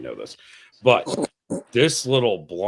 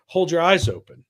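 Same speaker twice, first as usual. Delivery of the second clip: mock order; 7 semitones higher, 6 dB louder than usual. Trainer barking order in story.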